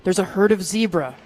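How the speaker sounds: hushed wildlife-show narrator; softly spot the animals; nature documentary. Pretend. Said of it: "just be quiet".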